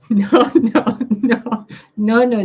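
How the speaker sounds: emphatic no. Real, not acted. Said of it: "That's not how it's done".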